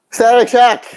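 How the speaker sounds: real sudden high-pitched excitement; gasp, then exclaim high; excited blurt. Real, not acted. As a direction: burst out with it as if just realizing. Gasps and blurts a sudden guess.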